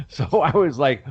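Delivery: usual speaking voice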